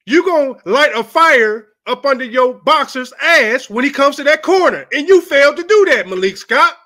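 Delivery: furious yelling. Real, not acted. Ranting at trainer Malik Scott, furious rising shout. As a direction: let the anger boil over until you're shouting. Rant escalating at Wilder's trainer.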